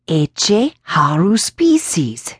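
very slow, eerie Latin chant; slow incantation. Acted. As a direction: intone it like an omen. Old woman intoning Latin.

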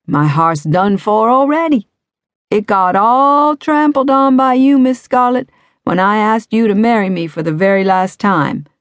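high, loud, hammed-up heartbreak; old rascal teasing the cop about his broken heart, hammed up; dramatic lament. A narrator's reading. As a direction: play the wounded old suitor to the hilt. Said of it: "Tommy sighed dramatically".

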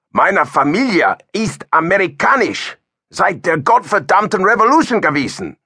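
understated anger, insulted at being called German; angry German reply. A narrator's reading. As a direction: bristle back at him in German. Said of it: "angrily replied in German".

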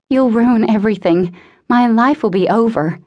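begging. A narrator's reading. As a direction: beg as if everything is falling apart. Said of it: "she begged".

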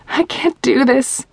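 breaking down, pleading through tears. A narrator's reading. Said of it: "I said in tears".

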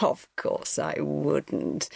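laughing it off. A narrator's reading. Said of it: "he was laughing as if".

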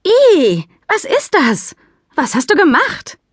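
high, panicked questions; alarmed. Acted. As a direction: squeal in horror at the stain. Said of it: "Alarmiert sprang sie auf".

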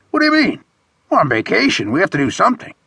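high, incredulous friend's protest. A narrator's reading. Friend insisting they do something on vacation, protesting. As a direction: start very high in disbelief, then press the point. Horace protests doing nothing.

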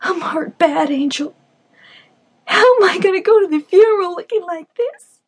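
high, loud tearful plea; starting to cry. Acted. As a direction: voice breaking on the edge of tears. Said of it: "She said as she started to cry".

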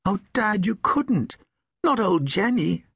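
put-on high-pitched pleading child. A narrator's reading. Son begs his father to spare the old donkey, pleading. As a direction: beg Dad, voice pitched right up. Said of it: "Sam pleaded".

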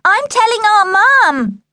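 very high, slow, loud tattling; high sing-out threat; sister's shouted threat. A narrator's reading. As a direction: holler it like a tell-tale sister. Sister shouts threat through shed door.

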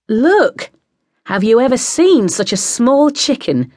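put-on high excited squeal, northern English accent. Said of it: "squealed the film star in a broad northern accent".

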